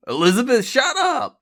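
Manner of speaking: loud shout leaping high; roar. A narrator's reading. Charlie roars at Elizabeth while firing, shouting. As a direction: roar over her to cut her off. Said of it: "Charlie roared".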